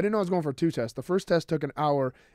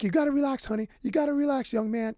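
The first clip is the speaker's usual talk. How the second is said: imitated nurse; 9 semitones higher than usual. Retells nurse urging him to relax.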